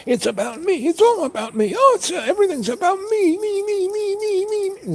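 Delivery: very high, loud mock tantrum; petulant. Shown as a demonstration. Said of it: "Isn't that petulant?".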